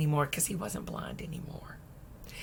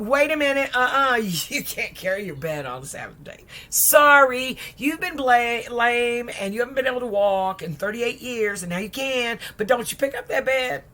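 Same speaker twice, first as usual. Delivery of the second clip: higher, louder indignant protest; scolding objection; 5 semitones higher, 6 dB louder than usual. Retold or imitated. Friends scold the healed man for carrying his bed, indignant. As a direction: wag a finger at the healed man.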